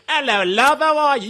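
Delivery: high, loud mock Cockney accent; Cockney chimney sweep. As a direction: call out a cheery hammed-up Cockney greeting. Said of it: "walking around like a chimney sweep".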